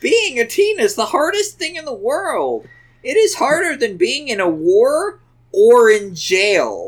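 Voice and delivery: high, pleading mock-tearful mom; very sad, meaning it. A narrator's reading. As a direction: plead earnestly, hammed-up sorrow. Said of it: "in a very sad and meaning it way".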